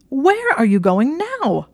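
high, loud, slow demand; indignant demand. Acted. Hermione as Draco walks off, indignant loud demand. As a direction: call after him in outrage. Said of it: "demanded indignantly".

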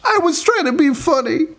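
very high defensive squeak; squeaky whine. Real, not acted. Caught making a pun, squeaks a high defensive protest.

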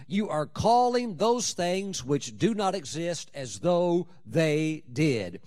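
very slow, deliberate phrasing; slow emphasis. Acted. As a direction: draw every word out for weight. Preacher repeating key phrase slowly.

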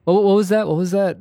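ordinary delivery

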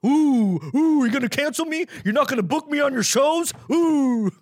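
high, loud mocking squeal; hammed-up mocking whine, high and loud; mock outrage. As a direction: taunt the cancel crowd in a shrill put-on voice. Mocking critics who cancel him.

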